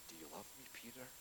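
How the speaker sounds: the Lord gently questioning Peter on the shore; tender question. Retold or imitated. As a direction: ask it softly, full of love. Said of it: "the Lord says to him".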